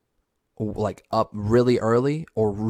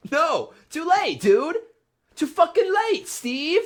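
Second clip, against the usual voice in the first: very high-pitched, hammed-up refusal; mock indignant rejection; slower than usual. Fan rejecting Steve's late explanation, mock indignant. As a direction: squeal the rejection in mock hurt.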